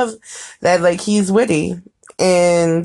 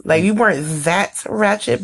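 ordinary talk, nothing marked

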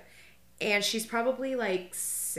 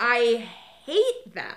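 put-on louder, slow, flat-out verdict from a little girl; blunt kid voice; 3 semitones higher, 7 dB louder, much slower than usual. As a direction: drop it slowly and loudly like a blunt child. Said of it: "And she's like".

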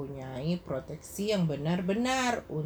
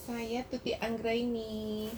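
faint and slow, too quiet; barely audible, far from the mic; 6 dB quieter, slower than usual. Real, not acted. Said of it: "Suara Bu Dokter masih pelan".

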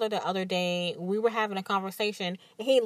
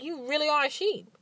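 a touch of scorn, a bit higher; scornful retort; 7 semitones higher than usual. Retold or imitated. Scolding her son's father in her head, mild scorn. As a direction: say "You really are a sheep" with mild contempt. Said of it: "in my head, I'm like".

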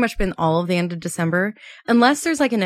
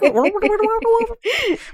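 high, fast garbled critter babble; Animal Crossing babble; 14 semitones higher than usual. Retold or imitated. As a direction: burble like an Animal Crossing villager. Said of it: "we sounded like Animal Crossing characters".

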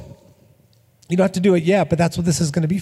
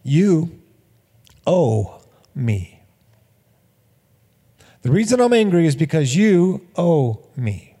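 resentful claim; slower than usual. Retold or imitated. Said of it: "And here's the line".

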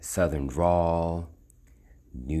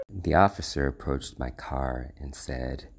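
ordinary talk, nothing marked

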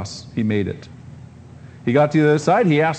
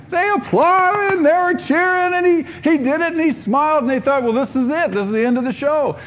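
excited; 11 semitones higher than usual. Retold or imitated. Excited crowd retold.

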